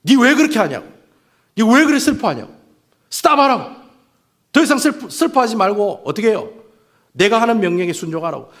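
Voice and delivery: God scolds the prophet, stop grieving; scolding God. Acted. God rebukes Samuel for his grief, loud scolding. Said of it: "막 꾸짖고 계시는 거예요".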